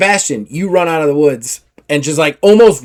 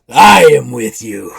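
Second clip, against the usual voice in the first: slow, louder, relishing every word; mad pirate woman; 3 semitones lower, 5 dB louder, slower than usual. Acted. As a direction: promise loyalty with a crazed glee. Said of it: "she's just got madness in her eyes".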